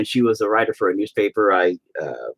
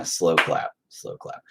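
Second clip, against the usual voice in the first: mock slow clap; 9 semitones lower, 7 dB quieter, slower than usual. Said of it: "That was a terrible one".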